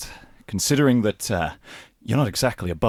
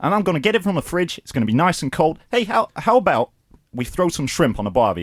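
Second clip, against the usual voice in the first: put-on light, higher Australian accent; lightly done Australian accent; Australian soap accent; 6 semitones higher than usual. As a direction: ham up an Aussie soap bloke. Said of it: "Australian soap".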